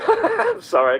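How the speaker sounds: breaking into real laughter; laughing. Real, not acted. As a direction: laugh through the apology, slowly. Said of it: "I can't say that with a straight face".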